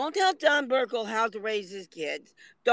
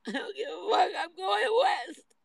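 higher, tearful voice, slowing on the last words; crying while giving up; 8 semitones higher than usual. Real, not acted. Upset streamer to viewers, higher tearful crying.

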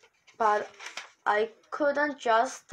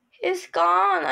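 a high, loud cry of dismay; heartbroken, blurting it out; a girl finds her dog's kennel empty and cries out; cried out; 7 semitones higher, 5 dB louder than usual. A narrator's reading. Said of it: "I cried".

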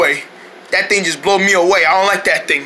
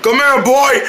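ordinary talk, nothing marked

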